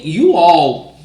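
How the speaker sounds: ordinary talk, nothing marked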